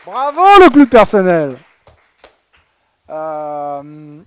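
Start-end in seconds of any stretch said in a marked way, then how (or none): 0.0-1.6 loud, high, hammed-up bravo; mock congratulations. Presenter hailing the winning buzzword with a loud, hammed-up cheer. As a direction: cheer the winner like a game-show host.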